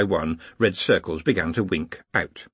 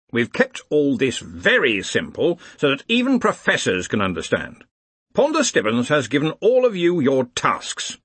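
higher-pitched, commanding university head; brisk leader briefing his staff; brisk Arch-Chancellor; 4 semitones higher, 3 dB louder than usual. Acted. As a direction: address the assembled wizards with bluff authority. Ridcully briefs the wizards.

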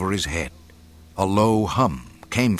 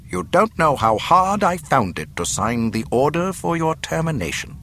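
higher, smoothly menacing official; courteous villainous governor; 8 semitones higher than usual. Acted. Governor telling the princess of her execution, mock courtesy. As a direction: condemn them with mock courtesy.

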